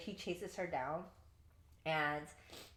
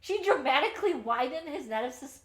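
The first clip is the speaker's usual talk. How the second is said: high, loud, laughing through it; Gideon's baffled musing; 7 semitones higher, 9 dB louder than usual. Retold or imitated. Gideon wondering aloud about Rue, laughing and high-pitched. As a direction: perform his bewilderment while cracking up. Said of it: "I love how he says".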